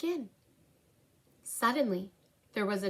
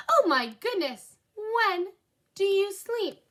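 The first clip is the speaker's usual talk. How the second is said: amazed customer; 9 semitones higher than usual. Acted. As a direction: marvel at how busy she is.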